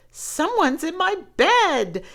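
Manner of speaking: high, loud little bear cry; small bear crying out. Acted. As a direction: cry out as the alarmed baby bear. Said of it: "the smallest bear cried".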